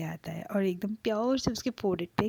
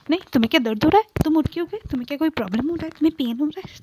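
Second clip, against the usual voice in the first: louder, fast, anxious rush of questions; worried questions; 6 semitones higher, 9 dB louder, much faster than usual. Acted. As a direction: pour out worried questions at speed. Boyfriend anxiously asks about pain.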